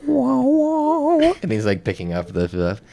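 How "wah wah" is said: high wailing "wah, wah"; sung-out sad instrument imitation; mock sad music. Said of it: "hearing the sad Chinese music in my head".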